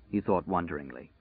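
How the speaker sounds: ordinary delivery, nothing marked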